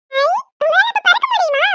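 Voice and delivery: put-on high, thrilled kid's voice; excited little child. Little girl thrilled, asking the superhero aunt. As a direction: gasp with a child's wonder.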